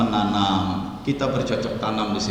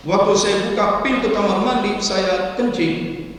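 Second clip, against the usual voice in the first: higher-pitched caller telling his story; animated, raised-pitch complaint; worried patient phoning; 9 semitones higher than usual. Acted. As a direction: play a patient reporting a jinn to the doctor. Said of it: "ditelepon oleh pasien".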